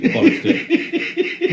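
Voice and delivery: high squeal of laughter as he gives in; laughing. Real, not acted. Teasing mate about to spill a story, laughing.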